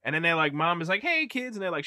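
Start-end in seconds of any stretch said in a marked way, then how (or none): 1.0-1.6 high mock-motherly greeting; imitated mom. Mom greeting the kids at home, imitated high greeting.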